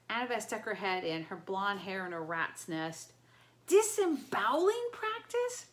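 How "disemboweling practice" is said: much higher, louder shocked question; horrified disbelief; alarmed. A narrator's reading.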